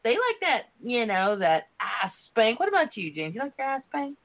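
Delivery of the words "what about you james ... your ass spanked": ordinary talk, nothing marked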